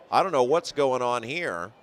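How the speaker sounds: normal talking voice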